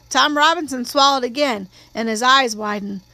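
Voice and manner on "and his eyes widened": ordinary delivery, nothing marked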